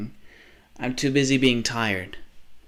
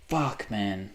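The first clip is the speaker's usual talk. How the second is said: quiet despair; 3 semitones lower, 8 dB quieter than usual. Real, not acted. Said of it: "I need help".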